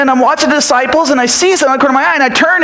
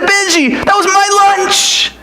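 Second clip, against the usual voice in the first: excited boast; 9 semitones higher than usual. Acted. Boy telling friend about fish, emphatic. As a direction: insist it was your lunch.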